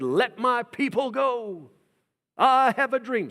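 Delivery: slow, high, loud proclaiming voice; bold Moses proclamation. Retold or imitated. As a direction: proclaim it like a prophet before the king. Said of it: "Moses marches into the court of Pharaoh and says".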